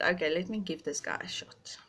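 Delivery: unvoiced inner thought; whispered thought. Deciding to give the guy a chance, whispered. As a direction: whisper it as a private decision.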